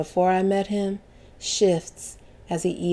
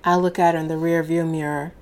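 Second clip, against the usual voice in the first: ordinary delivery, nothing marked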